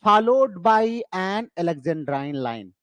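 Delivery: very slow, word by word; slow dictation. Shown as a demonstration. Teacher dictating the exam answer for students to write. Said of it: "तो आप बताएंगे".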